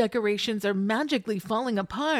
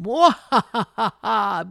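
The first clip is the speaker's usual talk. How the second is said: slow, loud villain cackle; evil villain laugh; 7 dB louder, slower than usual. Retold or imitated. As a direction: cackle like a cartoon baddie. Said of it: "Beetlejuice says".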